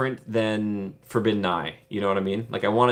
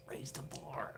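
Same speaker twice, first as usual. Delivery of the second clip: whisper; 23 dB quieter, slower than usual. Shown as a demonstration. Vlogger hoping for a better ride, whispered. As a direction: raise the bar whispered, voiceless.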